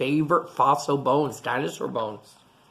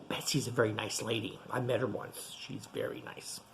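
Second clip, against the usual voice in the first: whispered aside; 5 semitones lower, 12 dB quieter than usual. Real, not acted.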